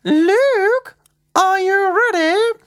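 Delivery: high, loud sing-out to another room; mum calling out. Acted. Mum to Luke before school, calling out. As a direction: call out high and drawn, as his mum. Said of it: "he heard his mum call out".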